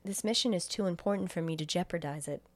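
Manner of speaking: low, quiet and even machine voice; a hint of a cold, polite AI; calm computer voice. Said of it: "And Hal says".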